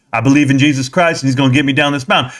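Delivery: lightly voiced old father, steady and sure; a hint of a wise dad at peace with fate; his calm father. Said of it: "He's like".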